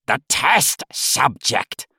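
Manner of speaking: a hint of a cranky old boss; snapping. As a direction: cut him off impatiently. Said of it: "the old man snapped at the technician".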